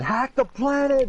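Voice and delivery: very high, drawn-out yell; hammed-up high-pitched battle cry; mock scream. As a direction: scream it while being dragged off. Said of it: "getting shoved into the car screaming".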